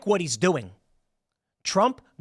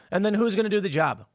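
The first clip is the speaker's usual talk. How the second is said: ordinary talk, nothing marked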